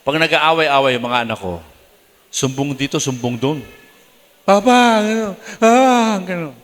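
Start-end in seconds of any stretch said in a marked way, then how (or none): 4.5-6.5 put-on high child's complaint; tattling child. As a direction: whine like a kid telling on sibling. Child tattling voiced in story.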